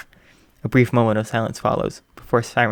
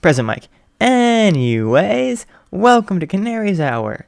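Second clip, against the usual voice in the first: slow, loud, higher announcer welcome; radio host intro; 7 semitones higher, 5 dB louder than usual. Acted. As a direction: announce it on air, drawn out. Radio host restarting the show.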